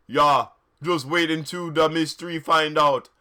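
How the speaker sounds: loud odd-voiced yell; hammed-up taunting yell. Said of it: "Vampire yelled".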